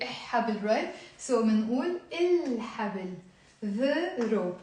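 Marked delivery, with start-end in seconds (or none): 0.0-0.6 إح حبل with a doubled h and no L; wrongly dropped lam. Shown as a demonstration. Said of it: "It sounds even wrong to say". 2.1-3.1 الحبل with the L clearly held; lam pronounced. Shown as a demonstration. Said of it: "منلفظ اللام".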